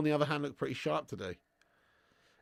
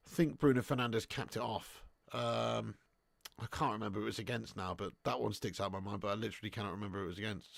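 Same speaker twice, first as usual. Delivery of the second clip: ordinary delivery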